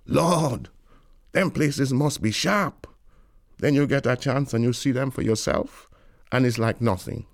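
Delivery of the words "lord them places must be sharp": higher, awed imagining of far-off places; dreamy wonder. Retold or imitated. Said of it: "You say to yourself".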